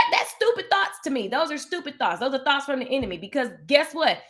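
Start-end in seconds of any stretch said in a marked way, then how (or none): none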